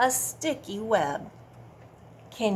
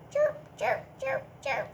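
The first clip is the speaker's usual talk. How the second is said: cricket chirp; 3 semitones higher than usual. Shown as a demonstration. Teacher modelling cricket noise for kids. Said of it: "Can you make that noise?".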